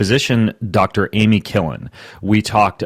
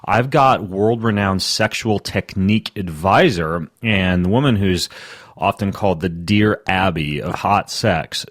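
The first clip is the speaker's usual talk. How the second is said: ordinary talk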